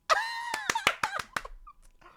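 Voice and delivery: shrieking laugh, way up high; high delighted cackle. Real, not acted. Streamer cracking up at Shantoto's line, high cackle.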